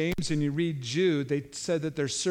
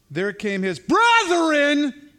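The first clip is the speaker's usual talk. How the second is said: hammed-up reading; 11 semitones higher, 10 dB louder, much slower than usual. Dramatic reading of scripture.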